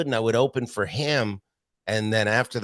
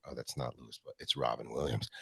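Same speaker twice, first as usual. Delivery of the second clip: 1 hushed surprise; 7 semitones lower, 12 dB quieter, faster than usual. Retold or imitated. Stunned realization, hushed.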